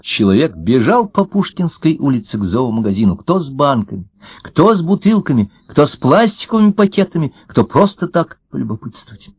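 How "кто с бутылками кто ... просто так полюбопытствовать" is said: ordinary delivery, nothing marked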